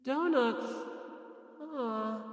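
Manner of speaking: soft, high, lost little echo; puzzled parroting. Acted. Fujisaki echoing Asahina's idea, softly bewildered. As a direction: repeat it blankly, caught off guard. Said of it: "Fujisaki parroted".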